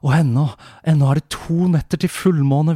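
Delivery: ordinary talk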